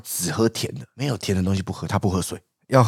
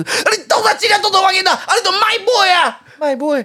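high, loud flustered mother voice; agitated mom imitation; 5 dB louder than usual. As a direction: play the worked-up mom scolding her son. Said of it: "我刚才模仿我妈".

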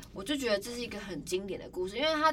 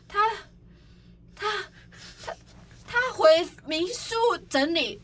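long pauses between repeated starts; imitating a reluctant stammering report; stalling haltingly; 9 semitones higher, 4 dB louder, slower than usual. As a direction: keep getting stuck, then get it out slowly. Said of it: "又突然又停在一个很奇怪的点".

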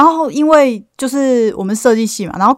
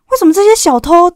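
raised, louder mock complaint, slowing at the end; indignant teacher; 8 semitones higher, 5 dB louder than usual. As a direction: play the baffled teacher who can't believe the thief stopped to snack. Neighbour teacher complains about thief.

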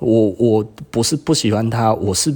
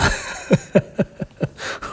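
breathy giggle up high; high airy laugh; 11 semitones higher, 4 dB quieter than usual. Real, not acted. Laughing to viewers about a great silk haul, high airy giggle. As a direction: laugh lightly at your own luck.

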